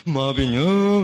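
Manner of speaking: hammed-up weeping as he begs forgiveness; mock sobbing apology. Son begging his mother's forgiveness at Lebaran, comic wailing sobs. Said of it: "ngomong sambil nangis kagak jelas ngomong".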